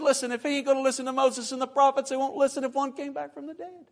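high-pitched answer, easing off in volume; firm rebuttal. Acted. Answer to the rich man's plea.

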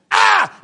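high loud panicked yelp; startled yelp. Retold or imitated. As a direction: yelp at a sudden stop ahead. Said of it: "I see that car and I go".

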